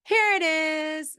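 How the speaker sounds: "Here it is" very high, slow and stretched; sing-song it to catch the child's eye; parentese. Shown as a demonstration. Therapist holding out Cocoa Puffs to a child, high and slow. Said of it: "you're kind of saying".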